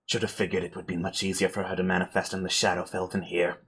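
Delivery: low, soft, winded murmur; spent spellcaster. Acted. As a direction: mutter quickly while recovering from a hard spell. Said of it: "still slowly catching her breath".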